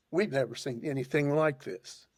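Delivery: ordinary talk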